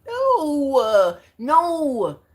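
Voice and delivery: high, loud "no, no" of disbelief; shocked refusal. Retold or imitated. To herself, learning favorite songs are AI, shocked no. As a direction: recoil in disbelief at the news. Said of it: "And I'm just like".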